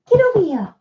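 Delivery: high calling to the dog. Real, not acted. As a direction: coax the dog over, high.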